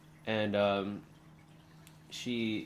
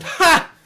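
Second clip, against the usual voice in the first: a sudden loud, high burst of laughter; 19 dB louder than usual. Acted. Orc woman laughing at the king's envoys, loud laugh. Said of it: "She laughs real loud".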